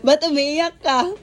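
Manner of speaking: loud, high, drawn-out teasing question; laughing tease. Real, not acted. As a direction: tease them loudly through laughter. Laughing at friend's happy tears. (natatawang pang-aasar, malakas at mataas)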